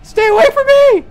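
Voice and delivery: high, loud frantic shrieks; shrieking. Real, not acted. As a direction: shriek the name at the ghost. Shrieking at the ghost.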